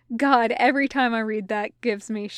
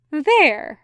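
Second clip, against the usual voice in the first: higher, louder, bright greeting; 5 semitones higher, 5 dB louder than usual. A narrator's reading. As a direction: say it brightly, lifting the pitch. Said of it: "Sandalphon said brightly".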